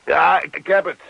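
paniekerig. Acted. Henk panicked, high loud.

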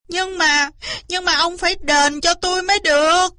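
high-pitched, insistent protest; pestering, hammed-up complaint; whiny demand. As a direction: whine for what you are owed. Tearful fox demands compensation.

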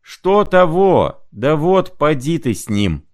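high, slow mocking shout; mocking retort. Zimoveykin mocking Prokharchin's mumble, high and slow. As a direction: throw his words back at him, pitched high.

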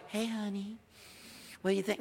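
a meek little hey honey, lightly mocked; playfully imitating a wimpy husband. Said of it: "I wasn't wimpy".

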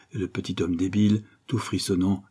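ordinary delivery

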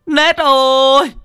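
high, loud anguished wailing; mother wailing up at her possessed child. Acted. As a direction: cry out for your lost daughter. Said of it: "Bà Na đứng dưới thì kêu gào".

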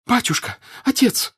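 high, shrieked appeal; pleading cry. A narrator's reading. Woman grabs Pierre's legs, crying out. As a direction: cry out as if clutching at a saviour. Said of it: "закричала она".

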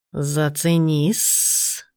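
slow low hissing snake; serpent voice. A narrator's reading. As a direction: drawl it like a smug serpent. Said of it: "предложил Полос".

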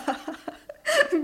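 quick teasing giggle; a daughter giggling at her mother; amused laugh. Acted.

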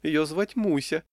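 high, slow flirty answer; playful feminine lilt; coquettish saleswoman. A narrator's reading. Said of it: "кокетливо прищурилась продавщица".

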